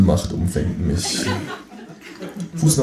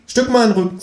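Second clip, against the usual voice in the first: high, perky kangaroo voice; talking kangaroo; 4 dB louder than usual. Acted. Kangaroo asking the narrator to move over, high cheeky voice. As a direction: squeak it out like a cheeky animal.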